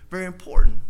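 booming preacher emphasis; proclaiming loudly to the congregation; sudden loud emphasis. Real, not acted. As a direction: lean hard into it, loud.

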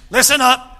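loud teacher's call to order; teacher calling the class to listen. Retold or imitated. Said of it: "any of you teachers ever do that in class".

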